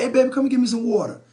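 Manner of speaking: quick, high, loud casual demand; male tone request. Retold or imitated. Man asking his woman for water, loud and blunt. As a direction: call out to her like a man who won't say please. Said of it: "but if I say".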